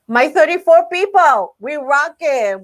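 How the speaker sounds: high, loud cheer; playful hype. Real, not acted. Host cheers on her thirty-four followers, excited rallying shout. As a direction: hype up your tiny crowd with pride.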